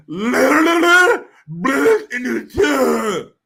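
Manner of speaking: mock fake crying. Showing chat how the actress sounded, a loud mock wail. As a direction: wail it like a bad actress faking tears. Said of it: "That's what she sounded like".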